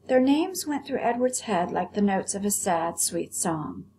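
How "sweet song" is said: usual speaking voice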